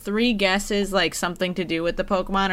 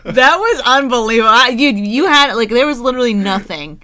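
exasperated; 3 semitones higher, 7 dB louder than usual. Real, not acted. Reacting to fake hints.

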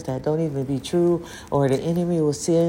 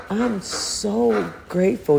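choked up and grateful; tearful, voice breaking; 6 semitones higher, slower than usual. Real, not acted. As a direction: let the voice crack with emotion. Emotional thanks, voice breaking.

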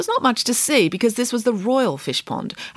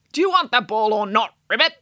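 frog; 3 semitones higher than usual. Acted. Frog presses.

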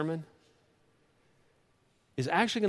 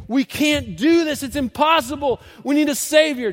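desperate cry; 11 semitones higher, 10 dB louder than usual. Acted. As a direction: cry out like a people at the end of their strength.

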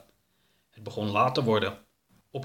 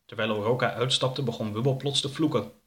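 usual speaking voice

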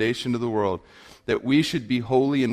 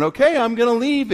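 high, singled-out mock warning to a child; mother's empty threat; 11 semitones higher, 4 dB louder than usual. Mom walking away from her boy, high mock threat. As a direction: imitate a mom threatening to go, pitch raised. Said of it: "and she's going".

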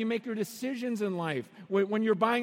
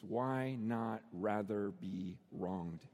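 low, soft, word-by-word quotation; hushed, measured and solemn; slow, quiet question; 13 semitones lower, 7 dB quieter, much slower than usual. Retold or imitated. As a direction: give each word gentle weight. Quietly quotes passage to wedding couple.

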